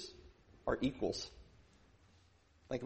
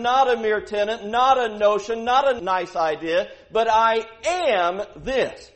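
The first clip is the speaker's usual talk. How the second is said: full-force preaching; 8 semitones higher, 15 dB louder than usual. Acted. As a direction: proclaim it like thunder from the pulpit. Preacher's sustained climax.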